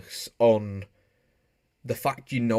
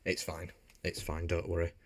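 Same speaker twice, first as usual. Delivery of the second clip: quiet reassurance; 4 semitones lower, 11 dB quieter than usual. Acted. Softly reassuring after joke.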